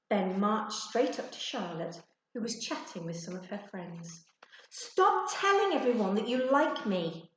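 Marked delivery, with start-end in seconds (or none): 4.7-7.3 loud, higher angry order. A narrator's reading. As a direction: tell her off in front of the class. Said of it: "Ben ordered".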